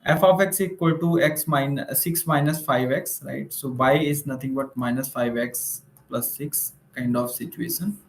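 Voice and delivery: normal talking voice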